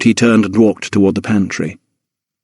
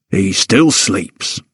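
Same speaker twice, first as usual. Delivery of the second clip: slow, gentler valet's voice; softened tone; much slower than usual. A narrator's reading. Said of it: "softening his tone a little".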